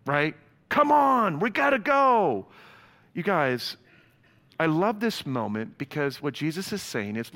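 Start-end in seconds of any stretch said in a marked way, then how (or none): 0.7-2.5 a high, loud, whiny hurry-up, the last part drawn out; mock an impatient kid urging everyone along. Pastor imitates an impatient churchgoer, loud whiny hurry-up.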